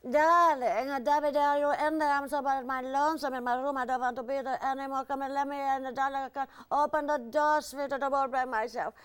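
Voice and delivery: put-on high, loud, cooing Hungarian accent; Eva Gabor impression. Eva Gabor at the dressing room door, high Hungarian cooing. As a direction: coo through the door like a lonely Hungarian diva. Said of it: "it's Eva Gabor".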